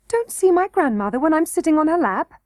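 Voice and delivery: can't believe he can't see her; incredulous. A narrator's reading.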